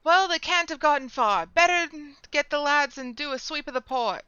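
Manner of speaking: angry guard. Acted. Port guard yells about the robbed storeroom, loud and high. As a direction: bellow like an outraged port guard.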